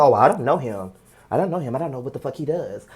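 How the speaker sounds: low, soft mock-clueless denial; feigned ignorance, pitched low and quiet; playing dumb. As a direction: act like you barely know him. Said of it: "Stop trying to play dumb and stupid".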